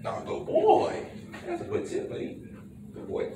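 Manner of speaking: very high, breathy-bright praise for the dog. Real, not acted. Trainer praising the puppy for sitting, in a very high praise voice.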